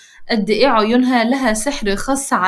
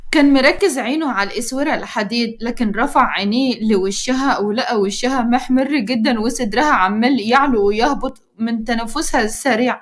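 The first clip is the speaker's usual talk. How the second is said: ordinary delivery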